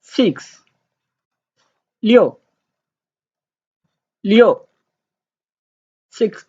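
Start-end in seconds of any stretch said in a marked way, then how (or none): 2.0-2.4 Liu pitched high, then dropping sharply; short falling Mandarin tone on Liu; fourth tone. Shown as a demonstration. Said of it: "the fourth tone". 4.2-4.6 Liu pitched high, then dropping sharply; short falling Mandarin tone on Liu; fourth tone. Shown as a demonstration. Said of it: "the fourth tone".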